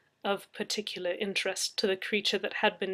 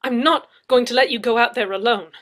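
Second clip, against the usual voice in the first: declaring; 3 semitones higher, 13 dB louder than usual. Acted. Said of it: "I declared".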